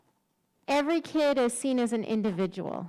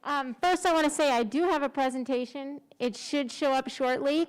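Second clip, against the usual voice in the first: usual speaking voice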